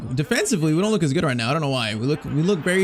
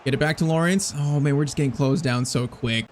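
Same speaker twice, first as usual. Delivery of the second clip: normal talking voice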